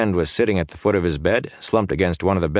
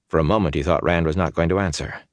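usual speaking voice

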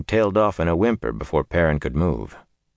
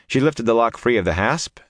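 ordinary delivery, nothing marked

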